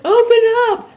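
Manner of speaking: high, loud holler; loud door-knock shout. Retold or imitated. Room checkers demanding the suitemate open up, obnoxiously loud. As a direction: yell it like you're pounding on a door. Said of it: "they go knock obnoxiously loud".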